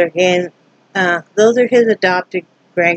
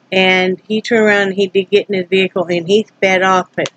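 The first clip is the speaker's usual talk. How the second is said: ordinary delivery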